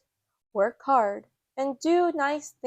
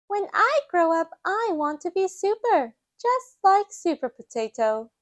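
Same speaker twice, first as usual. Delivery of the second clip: put-on high, eager little girl voice; high child voice telling her mum; Peppa; 8 semitones higher, 3 dB louder than usual. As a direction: dream out loud like a small child. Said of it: "Peppa tells Mummy Pig".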